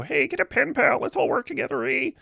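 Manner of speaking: high, mock Canadian accent with an eh. Retelling Peterson's outreach, mocking it in a high Canadian accent. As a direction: ham up a Canadian nice-guy accent.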